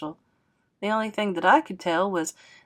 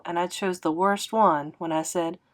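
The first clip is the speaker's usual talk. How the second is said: usual speaking voice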